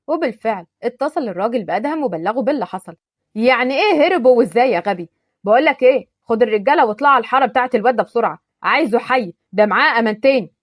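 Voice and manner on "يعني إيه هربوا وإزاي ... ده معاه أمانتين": furious yelling. Acted. Boss furious his men lost them.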